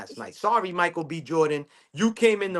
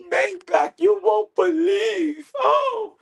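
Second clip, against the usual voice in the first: much higher, very slow, wailing mock drama; dramatic woman; 3 dB louder, much slower than usual. Mocking a woman calling Maybach, high slow wailing mock drama. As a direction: stretch it out like a soap opera heroine. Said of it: "the women are so dramatic".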